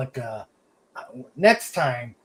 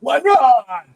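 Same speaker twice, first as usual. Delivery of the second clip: much louder, higher greeting call; 11 semitones higher, 11 dB louder than usual. Real, not acted. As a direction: hail him as he arrives. Calling out greeting to arrival.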